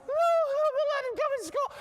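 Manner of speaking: very high, loud mock wailing child; crying little girl. Six-year-old answering teacher about gum, exaggerated high crying. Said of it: "This poor little girl's crying".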